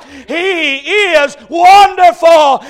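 loud, high, slow shout of wonderful; shouted climax. Acted. Preacher to congregation at the climax, a loud slow shout. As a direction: ring it out at the peak. Sermon climax then hush.

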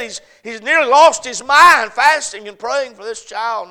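loud, rising preacher's cadence; full-force preaching. Acted. Preacher retells David's grief to the church, loud and fervent. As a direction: drive the story home to the congregation.

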